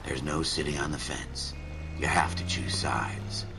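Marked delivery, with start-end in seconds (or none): 2.0-3.5 whispered noir narration. A narrator's reading.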